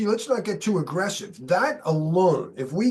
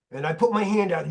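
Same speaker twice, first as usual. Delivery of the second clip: normal talking voice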